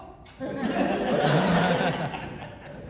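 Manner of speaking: high loud laugh; burst of laughter. Real, not acted. Son asked about the shoe, bursts out laughing. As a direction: laugh out at the question.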